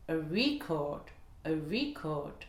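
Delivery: RE stressed in "record", CORD light; noun stress. Shown as a demonstration. Teacher demonstrates the noun, stress on RE in "record". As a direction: hit the start: a RE-cord. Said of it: "l'enregistrement sera sur la première voyelle".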